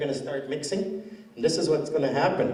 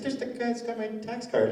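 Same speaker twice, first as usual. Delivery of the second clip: spotting him; 8 semitones higher than usual. Retold or imitated. Speaker spots the clerk.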